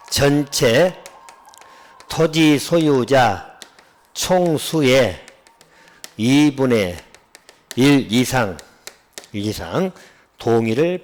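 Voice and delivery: very slow dictation with firm phrase breaks; slow recitation. Shown as a demonstration. Teacher expanding the shorthand for students, slow and deliberate. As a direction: measure out each phrase, pausing after it.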